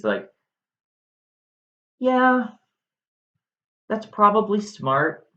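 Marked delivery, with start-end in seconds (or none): 2.0-5.3 much higher, breezy yeah; mock offhand Aegeus. Aegeus shrugs off Medea's exile, high and breezy. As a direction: chirp it carelessly. Aegeus agrees she's banished.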